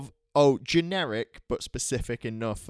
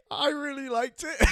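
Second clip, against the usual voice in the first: giggling through a sheepish admission; sheepish giggly confession; laughing confession; 11 semitones higher, slower than usual. Real, not acted. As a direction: laugh it out, embarrassed.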